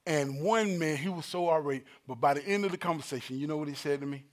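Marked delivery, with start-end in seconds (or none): none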